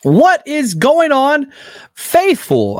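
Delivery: loud, high, drawn-out greeting; big show opener. Real, not acted. Host welcoming his live viewers, loud stretched hype greeting. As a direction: hype the crowd as the show kicks off, slowly.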